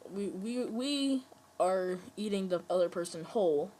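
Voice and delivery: ordinary delivery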